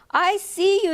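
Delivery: put-on boy calling to his duck; yelling. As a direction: call out across the pond. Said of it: "yelled Willie".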